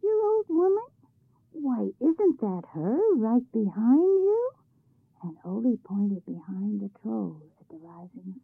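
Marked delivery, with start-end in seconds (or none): 0.0-4.5 high, mock-surprised question, slow second line; sly teasing. A narrator's reading. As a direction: feign surprise to trick the troll. Ole teasing the troll.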